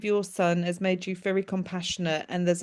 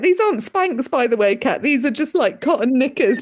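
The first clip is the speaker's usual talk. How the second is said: loud, cheeky retold correction; raised-voice mock explanation; teasing correction; 5 semitones higher, 8 dB louder than usual. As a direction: belt it out on the edge of laughter. Son teasing, she breaks into laughter.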